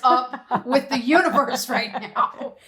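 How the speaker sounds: a laughing rant at the universe, drawn out slow; laughing exasperation. Retold or imitated. Telling a friend about the trip, laughing disbelief. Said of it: "you're like".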